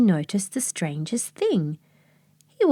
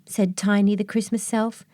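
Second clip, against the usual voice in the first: ordinary delivery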